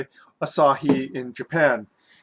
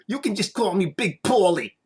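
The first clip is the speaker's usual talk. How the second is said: a hammed-up New Jersey accent, pitched higher; terrible New Jersey accent; 4 semitones higher than usual. Guy joking he looks like a Sopranos extra, bad New Jersey accent. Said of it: "that was a terrible impression of a New Jersey accent".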